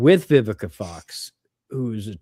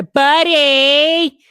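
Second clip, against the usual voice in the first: loud, high, held-out Jersey holler; mock New Jersey accent; 10 dB louder than usual. Imitating women on Cake Boss, loud New Jersey accent. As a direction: imitate a Jersey woman hollering, last vowel stretched. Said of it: "any one of the women came up".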